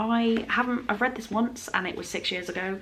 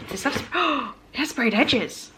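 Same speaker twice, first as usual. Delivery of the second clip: delighted gasp; 6 semitones higher than usual. Real, not acted. Unboxing a special edition.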